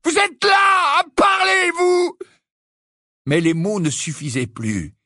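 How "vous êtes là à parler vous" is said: high, slow, loud anguished yell; raging. A narrator's reading. Harry accusing a talking Dumbledore, screaming. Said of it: "gronda Harry".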